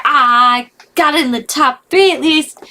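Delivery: proud. Real, not acted. Proud of guess.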